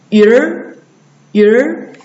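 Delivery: 鱼儿 as ü gliding into curled r; erhua demo. Shown as a demonstration. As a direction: model 鱼儿 twice for learners. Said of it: "鱼和儿啊".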